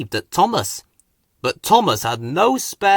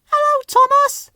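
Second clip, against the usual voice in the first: put-on much higher, a bright child's call; children calling; 4 dB louder than usual. A narrator's reading. Children on bridges call hello to Thomas. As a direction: call out like kids waving from a bridge. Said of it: "they called".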